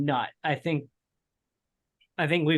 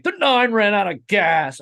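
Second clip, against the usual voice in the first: slow, higher, louder radio call; 6 semitones higher, 6 dB louder than usual. Retold or imitated. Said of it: "I heard the, the radioactive clips".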